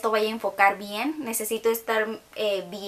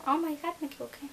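sudden higher yelp of dismay, then trailing quieter; startled oops; 6 semitones higher, 6 dB quieter, faster than usual. Real, not acted. Beauty vlogger realizing she applied the wrong shade, startled and higher. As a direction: caught off guard by her own mistake. Makeup slip while filming.

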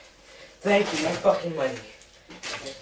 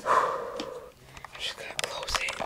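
whispering; 11 dB quieter than usual. Acted. Sneaking inside the house.

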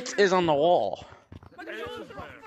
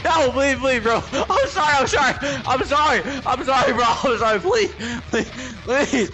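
mock begging; 7 semitones higher than usual. Player begging the opponent not to kill him. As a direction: beg for mercy, hammed up. Begging while being killed.

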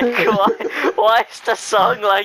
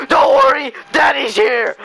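loud, high shouted imitation of a singer; mock screamed reassurance; 3 semitones higher, 4 dB louder than usual. Imitating the end of the song to friends, screamed. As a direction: belt out the comfort at a shout. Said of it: "he's screaming it down the song".

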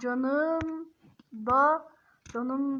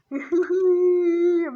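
loud, higher laugh breaking into a cry; 3 semitones higher, 5 dB louder than usual. Real, not acted. Laughing helplessly at the chatbot's insult. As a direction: howl with laughter.

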